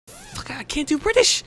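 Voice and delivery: high-pitched frustrated outburst, slowed and loud; freaking out. Real, not acted. Player panicking over the British voice, high loud freak-out. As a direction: squeal in panic at your own failed accent. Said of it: "stop freaking out".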